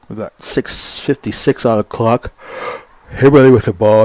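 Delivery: yawning. Real, not acted. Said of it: "I'm still yawning".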